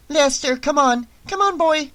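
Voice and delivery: put-on high small girl calling a dog; little girl voice. A narrator's reading. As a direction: coax the dog away like a young girl. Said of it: "a little girl's voice".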